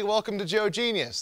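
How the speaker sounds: usual speaking voice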